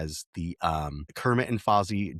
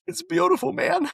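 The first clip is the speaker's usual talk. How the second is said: very high, squeaky pretend weeping; hammed-up tearful squeal; mock crying; 4 dB louder than usual. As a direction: pretend to choke up in a high wail. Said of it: "I don't know why I'm crying".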